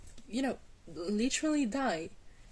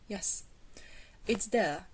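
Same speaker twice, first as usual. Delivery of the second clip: ordinary talk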